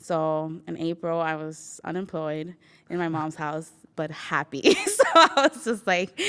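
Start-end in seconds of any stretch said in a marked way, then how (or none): 4.9-6.2 higher, louder, bubbling laugh; laughing joy. Real, not acted. Unemployed at mom's but happy, laughing. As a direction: laugh out the happiness.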